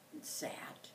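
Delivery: whispered and sat and sat, trailing off; hushed and fading. A narrator's reading. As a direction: let the waiting fade to a whisper. Waiting drawn out.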